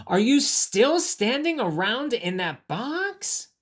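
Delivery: high, slow, singsong disbelief; exaggerated storybook questioning; incredulous question. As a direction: ask it big and amazed. Reads the question to ask bunny.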